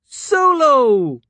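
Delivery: high, loud delighted squeal; girl squealing with relief at the big man; happy squeal. A narrator's reading. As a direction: squeal his name in joy. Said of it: "Elise squealed".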